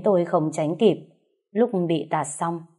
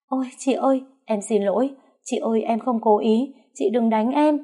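high, whimpering plea; fake pleading; 5 semitones higher than usual. The mistress begs the wife not to hit her, high and whiny. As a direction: beg like a frightened victim, put on.

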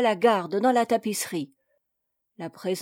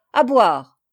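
loud, commanding call; loud demand; 8 dB louder than usual. A narrator's reading. Captain calling his servant for drink, loud demand. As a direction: call out sharply for service.

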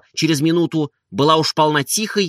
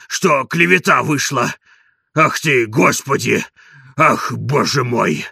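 husky, wheezy exclaiming and self-reproach; hoarse old servant; 3 dB louder than usual. A narrator's reading. Servant exclaims over the bride and confesses, hoarse voice. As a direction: rasp out wonder and contrition. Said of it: "твердил он".

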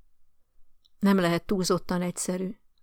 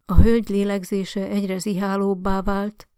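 ordinary delivery, nothing marked

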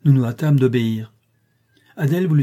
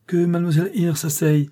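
impatient; 6 semitones higher than usual. A narrator's reading. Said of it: "son ton impatient".

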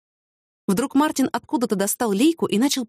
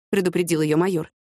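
usual speaking voice

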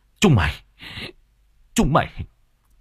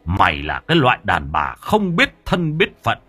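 mad with rage; 5 semitones higher than usual. A narrator's reading. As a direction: berate her, voice climbing into fury. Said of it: "điên lại càng điên". (điên tiết mắng nhiếc)

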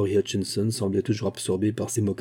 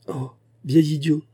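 higher, louder exasperated cry; 6 semitones higher, 3 dB louder than usual. A narrator's reading. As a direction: call him an old fool out loud. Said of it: "cria Dora". (cri exaspéré, plus aigu)

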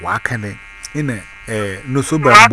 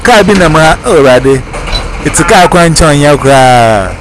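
loud insistence; 3 semitones higher, 7 dB louder than usual. Real, not acted. Heated loud telling in argument.

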